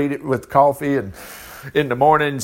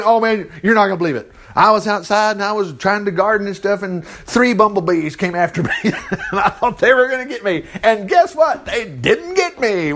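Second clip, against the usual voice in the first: high, fast, breathless praise report; excited report; 5 semitones higher than usual. Retold or imitated. Churchgoer telling a bumblebee escape, excited and high. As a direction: gush like a thrilled churchgoer.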